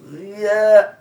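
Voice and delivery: জিয়া with z, loud and high; wrong জিয়া. Shown as a demonstration. Teacher to class, loud wrong জিয়া. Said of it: "দিয়া আর জিয়ার উচ্চারণের".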